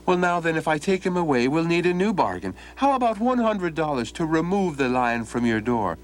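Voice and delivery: high, sly bargaining lad; sly Donald. Acted. Donald slyly demands a hundred dollars, high and cheeky. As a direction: drive a hard bargain with a grin.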